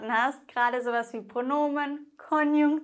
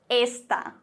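a blunt, curt "esta."; curt answer. Retold or imitated. Teacher answering the wine question, curtly, not very politely. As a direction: answer bluntly, just pointing. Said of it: "nicht besonders höflich".

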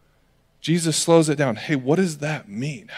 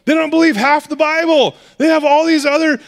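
high, loud outraged disbelief; shocked outburst; 12 semitones higher, 5 dB louder than usual. Retold or imitated. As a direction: shout it like minds exploding. Crowd's shocked reaction acted out.

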